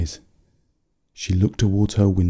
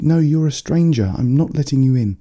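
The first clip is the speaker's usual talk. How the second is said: put-on young girl voice; 7 semitones higher, 4 dB louder than usual. A narrator's reading. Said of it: "she said".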